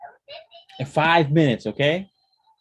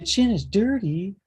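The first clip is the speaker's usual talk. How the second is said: high, teasing repeat; playful echo; 11 semitones higher than usual. Real, not acted. Father echoing child, high playful.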